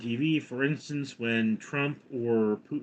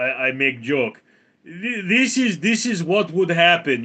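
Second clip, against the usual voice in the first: put-on higher, louder Russian accent; 5 semitones higher, 4 dB louder than usual. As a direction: set up a joke in a Russian accent. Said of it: "I do a Russian accent".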